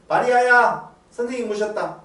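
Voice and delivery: a hint of calling out, loud and high. Martha calling her sister Mary, loud and high. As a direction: call her name across the house.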